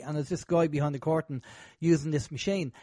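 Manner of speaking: ordinary talk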